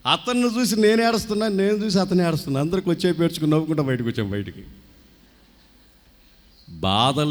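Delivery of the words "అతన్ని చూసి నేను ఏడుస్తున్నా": ordinary talk, nothing marked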